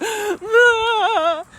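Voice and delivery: high, loud crying gasp; a sudden sob breaking out; loud high-pitched sob. Retold or imitated. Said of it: "that was the end of me".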